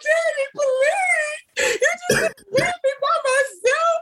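very high, stretched-out wail; squealing comic sobs; mock bawling. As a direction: wail hysterically for daddy, hammed up. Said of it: "bawling my eyes out".

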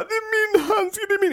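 put-on high whiny kid voice, upset; whiny child. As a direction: imitate a sensitive child about to cry. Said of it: "han bara".

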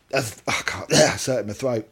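ordinary delivery

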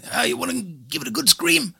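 imitating a cheery American tourist. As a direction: play the upbeat American stranger. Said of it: "kommer det en amerikaner, og bare sånn".

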